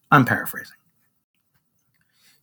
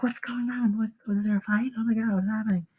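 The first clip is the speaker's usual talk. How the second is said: high, hushed alarmed bystanders; murmuring onlookers; 7 semitones higher, 8 dB quieter than usual. Acted. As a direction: play a flustered crowd, small and high. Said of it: "all the other people are like".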